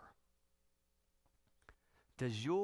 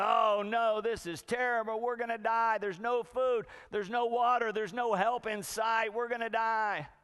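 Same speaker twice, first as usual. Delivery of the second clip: hammed-up high, loud panic; frantic panic; 6 semitones higher, 4 dB louder than usual. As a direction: wail each new disaster like a frantic castaway. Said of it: "one guy is just frantic".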